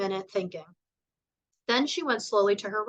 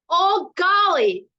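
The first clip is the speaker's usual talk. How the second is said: slow, loud, much higher furious outburst; 10 semitones higher, 7 dB louder, much slower than usual. A narrator's reading. Harriet furious at old Golly's riddles, slow outburst. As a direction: drag it out in a rage. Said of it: "She was really furious".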